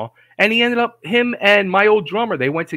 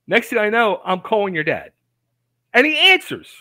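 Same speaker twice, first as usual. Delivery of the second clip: ordinary talk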